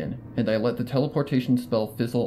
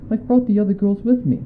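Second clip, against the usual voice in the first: higher, louder genteel mare at the door; Rarity's voice; 9 semitones higher, 6 dB louder than usual. A narrator's reading. Rarity speaking through the door to Twilight, higher and louder. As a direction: raise the pitch and call out politely. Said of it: "she says".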